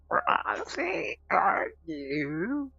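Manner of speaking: very high, loud game-character voice; Tamagotchi Life voice. Acted. Streamer mimics a game's talking character, Tamagotchi Life voice. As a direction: pitch it way up like a garbled game character. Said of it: "what was that, Tamagotchi Life?".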